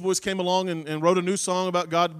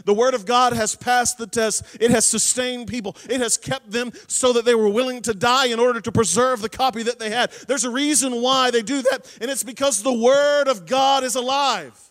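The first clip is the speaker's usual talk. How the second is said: louder, driving sermon voice; preaching at full force; 6 dB louder than usual. Acted. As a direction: preach it out to the congregation.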